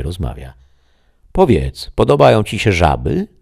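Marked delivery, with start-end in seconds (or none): 1.3-3.3 higher, louder insistent question; the voice repeating. Acted. The unseen voice asks the shadow again, higher and louder. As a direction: press the question again, eager. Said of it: "głos ponowił pytanie".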